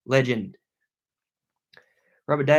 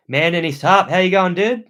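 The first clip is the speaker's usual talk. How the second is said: loud, higher excited greeting; yelling; 7 semitones higher, 9 dB louder than usual. Real, not acted. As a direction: call out to the viewer, full volume. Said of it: "I'm still yelling".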